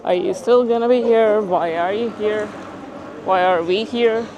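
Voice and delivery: loud, high mocking questions; sarcastic. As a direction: throw the questions out with mock disbelief. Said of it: "almost sarcastically".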